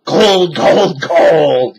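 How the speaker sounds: loud, greedy gold cry; captain crying out. A narrator's reading. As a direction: shout with greedy delight. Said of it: "he cried".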